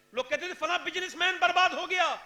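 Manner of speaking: ordinary talk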